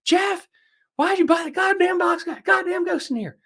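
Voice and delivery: mock screaming wife, way up high and loud. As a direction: shriek at Jeff like a furious wife. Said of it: "she's like".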